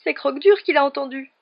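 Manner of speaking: high, loud, panicky voice. A narrator's reading. Ron blaming the dog, high-pitched and alarmed. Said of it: "d'une voix plus aiguë qu'à l'ordinaire".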